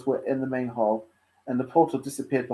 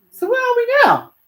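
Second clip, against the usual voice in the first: put-on high girl voice; 9 dB louder than usual. A narrator's reading.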